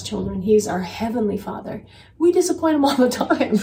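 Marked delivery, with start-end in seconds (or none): 2.2-3.6 real laugh through the words, higher; chuckling while admitting it; amused confession with a laugh. Real, not acted. Laughs at own remark.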